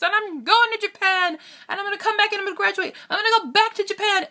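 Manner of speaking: put-on high, breathless run of childhood plans; eager kid self. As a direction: imitate your excited younger self rattling off plans. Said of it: "as a kid, I was always".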